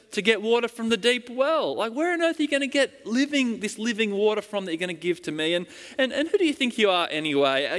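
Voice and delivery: high, incredulous retort at a stranger; mock indignant woman. Samaritan woman scoffing at Jesus, high and incredulous. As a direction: scoff at him like a skeptical villager. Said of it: "she's like".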